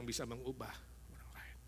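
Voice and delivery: soft unvoiced whisper. Preacher whispering the key point to listeners. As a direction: let the truth sink in hushed.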